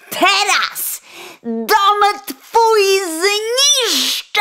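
high, loud, slow menace; vengeful threat. Acted. Sparrow vowing to ruin the coachman's house, high. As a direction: drag out the threat to his home.

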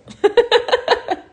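short high giggle; light laugh. Real, not acted. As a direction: laugh at your own joke. Laughing at own age joke.

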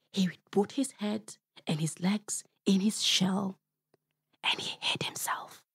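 whispered as the tortoise hides; hushed narration. A narrator's reading. Storyteller whispering as Mzee Kobe hides in his shell. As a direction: whisper it, tucking away.

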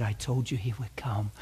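hushed whispered aside. Retold or imitated. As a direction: lean in and whisper it close. Whispered aside to crying couple.

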